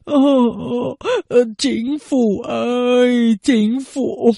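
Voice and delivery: slow, drawn-out lament; a mild lament, head in his hands; soft crying. A narrator's reading. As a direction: weep out the call to the government. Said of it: "khe khẽ cất tiếng khóc".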